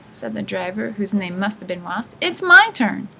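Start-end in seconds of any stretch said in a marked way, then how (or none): 2.2-3.1 high, loud, insistent protest; shrieked bickering; squabbling. A narrator's reading. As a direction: insist on it like a quarrelling old hag. Old sisters squabbling.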